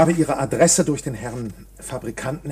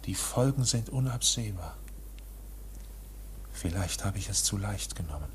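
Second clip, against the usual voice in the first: leises Selbstgespräch; 8 semitones lower, 13 dB quieter than usual. Acted. K. wiederholt leise für sich.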